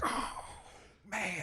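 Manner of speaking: drawn-out, weary "oh, man"; quiet groan of impatience; exasperated groan. Acted. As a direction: sigh it out softly in mock dismay. Exasperated shopper's reaction acted out.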